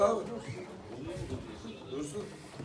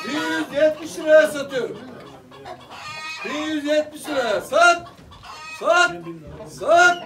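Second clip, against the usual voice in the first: auctioneer. Acted. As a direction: call the bids out like an auctioneer. Auction calling bids.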